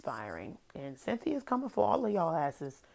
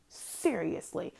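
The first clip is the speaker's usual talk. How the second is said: normal talking voice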